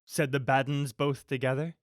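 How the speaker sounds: ordinary delivery, nothing marked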